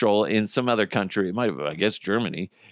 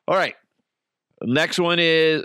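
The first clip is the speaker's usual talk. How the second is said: usual speaking voice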